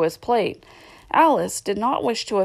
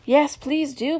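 high, pleading little request; 7 semitones higher, slower than usual. A narrator's reading. Alice begging the Dormouse for a story, pleading. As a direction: plead lightly, pitch lifted. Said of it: "pleaded Alice".

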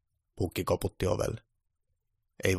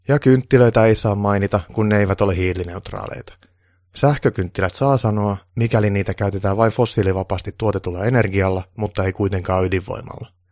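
a hint of a loud, lecturing official; lecturing advisor; 11 dB louder than usual. Official lectures Santa about forbidden words, a little loud. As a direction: lay down the rules to Santa firmly.

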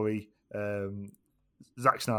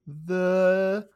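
drawn-out, super slow delivery; slow motion; 5 dB louder, much slower than usual. Pretend. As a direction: stretch every word like slow motion. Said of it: "I'm making it a four-hour podcast by speaking in slow motion".

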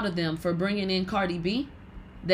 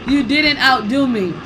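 firm, louder put-down; loud retort; 3 semitones higher, 6 dB louder than usual. Real, not acted. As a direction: shut the rival down. Retort after laughter.

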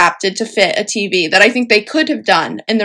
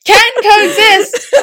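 laughing; 9 semitones higher, 3 dB louder, slower than usual. Real, not acted. Laughing agreement.

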